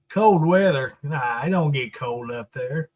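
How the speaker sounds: usual speaking voice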